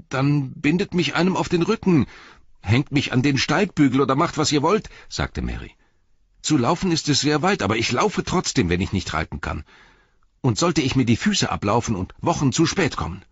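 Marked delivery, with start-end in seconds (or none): 0.1-4.9 much higher and louder, urgent pleading; desperate hobbit plea. A narrator's reading. 6.4-13.2 much higher and louder, urgent pleading; desperate hobbit plea. A narrator's reading.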